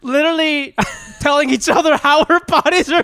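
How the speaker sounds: very high voice breaking up with laughter; real laughing fit. Real, not acted. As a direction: struggle to get it out between laughs. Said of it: "Why are you laughing".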